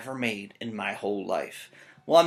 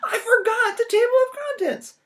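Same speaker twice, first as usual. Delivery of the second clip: very high, loud, half-laughing wail; panicked Jack; 6 dB louder than usual. Acted. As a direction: wail over the forgotten contents.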